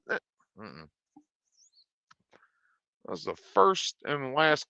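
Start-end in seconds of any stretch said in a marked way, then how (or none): none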